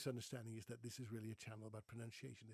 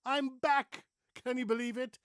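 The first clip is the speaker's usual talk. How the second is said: big, bright, loud hello after a break; thrilled to be back on the channel; excited comeback greeting; 10 semitones higher, 14 dB louder than usual. Real, not acted. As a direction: announce your return with a burst of energy.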